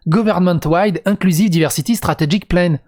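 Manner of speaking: "Government-wide inclusive diversity strategic plan" in a thick French accent; how he really speaks English at work, French accent. Shown as a demonstration. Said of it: "J'ai le putain d'accent français". (accent français prononcé)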